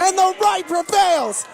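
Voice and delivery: triumphant proclamation. Real, not acted.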